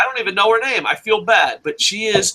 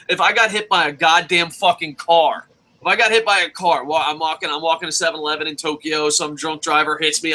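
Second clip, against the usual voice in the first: normal talking voice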